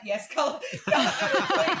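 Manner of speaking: answering the colour editor, laughing; laughing reply. Retold or imitated. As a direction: giggle it out. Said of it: "I'm like".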